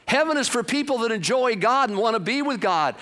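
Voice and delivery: ordinary talk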